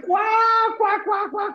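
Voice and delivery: high imitated cackle of mom laughing; mock cackle. Son mimics mom's triumphant cackle, mock cackle.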